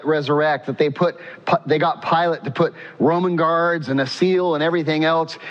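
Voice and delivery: usual speaking voice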